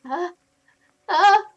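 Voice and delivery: loud confused huh; Carl grunting. Retold or imitated. GM voicing Carl's confused grunt, loud. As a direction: bark it out loud and puzzled. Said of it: "you hear Carl go".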